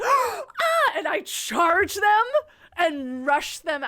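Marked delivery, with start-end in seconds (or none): 0.0-0.4 very high, loud startled shriek. Retold or imitated. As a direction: shriek as she spots the knife. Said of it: "she's like".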